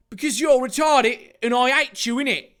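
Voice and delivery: high, loud character voice; angry comeback. Acted. Jack answering Dave's demand, angry. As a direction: throw the insult back high and loud. Jack's acted quarrel with Dave.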